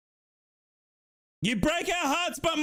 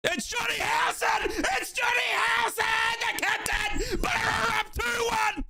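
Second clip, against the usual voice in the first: higher, roaring goal call; goal celebration; 6 semitones higher than usual. Real, not acted. Commentator celebrating the captain's winning penalty, roaring. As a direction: scream the scorer's name to the heavens.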